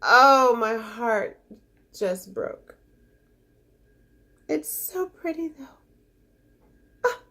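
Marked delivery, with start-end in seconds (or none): none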